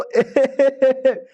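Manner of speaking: a high, sudden laugh; amused laugh. Acted. Main character laughs at the shameless offer, high laugh. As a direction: burst out laughing at the idea. Sleazy idea makes him laugh.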